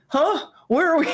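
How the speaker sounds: high, confused huh; dazed Peter. Retold or imitated. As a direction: sound lost and dopey. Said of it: "Peter's just like".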